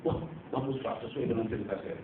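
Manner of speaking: pleased murmur. Retold or imitated. Naive activist reading statement.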